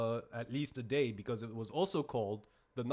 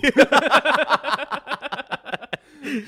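big open laugh; loud laugh; 12 semitones higher, 15 dB louder than usual. Real, not acted. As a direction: burst out laughing.

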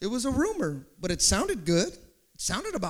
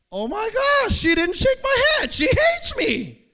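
high, loud, slowing on "She hates me"; hurt outraged shout; 13 semitones higher, 11 dB louder than usual. Acted. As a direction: cry out wounded. Believer shouts hurt realization.

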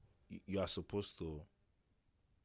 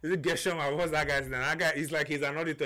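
usual speaking voice

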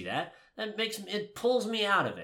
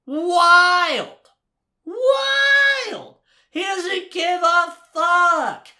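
shouting high and loud in disbelief; real excited yell of amazement; amazed shout; 12 semitones higher, 8 dB louder, much slower than usual. Real, not acted. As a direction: belt it out, blown away. Amazed at Neville scene.

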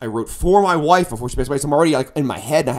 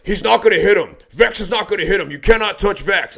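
raised, insistent voice imitating roadies; protective protest; 5 semitones higher, 5 dB louder than usual. Roadies holding off bouncers, raised protective protest. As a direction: insist it firmly, a bit higher. Retold roadies defending the singer.

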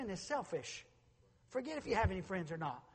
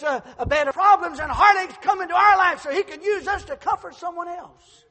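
louder, higher preaching that keeps building; rising preaching; 9 semitones higher, 14 dB louder than usual. Acted. Pastor urging the congregation, loud and rising. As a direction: swell it into a sermon cry.

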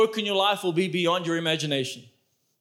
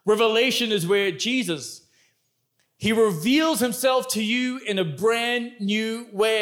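ordinary talk, nothing marked

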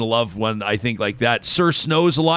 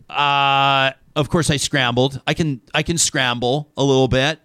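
ordinary delivery

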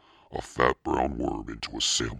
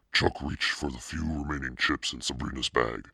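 ordinary delivery, nothing marked

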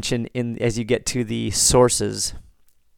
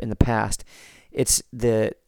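normal talking voice

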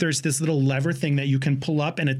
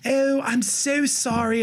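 high, drawn-out mock New Zealand apology; New Zealand accent; 6 semitones higher, slower than usual. Flight attendant apologizing to passenger, slow high New Zealand accent. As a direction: stretch the vowels in a put-on New Zealand accent. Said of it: "And she's like".

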